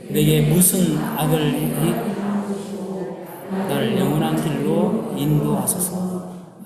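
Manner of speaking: slow, measured congregational reading; scripture reading aloud. Acted. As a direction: lead everyone through the verse, drawn out. (천천히 또박또박 성경 봉독)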